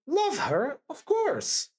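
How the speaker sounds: high-pitched plaintive protest; pained, emphatic young Sonia, pitched well up; plaintive emphasis. A narrator's reading. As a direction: plead it with rising, high emphasis. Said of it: "said Sonia with plaintive emphasis".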